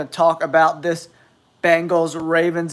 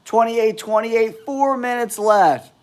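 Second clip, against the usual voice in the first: high, announcer-like game update; heightened sportscaster delivery; excited score update; 6 semitones higher than usual. Real, not acted. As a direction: announce the tight score, pitched well up. Announces score late in close game.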